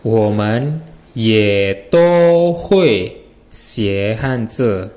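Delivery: each syllable drawn out slowly and evenly; very slow Mandarin sentence. Shown as a demonstration. Teacher dictates the sentence very slowly to students. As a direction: spell the sentence out word by word. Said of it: "Kalimatnya jadi gimana?".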